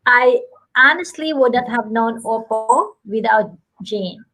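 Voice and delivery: ordinary delivery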